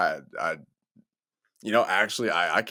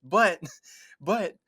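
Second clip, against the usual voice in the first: laughing plea; 8 semitones higher, 5 dB louder than usual. Retold or imitated.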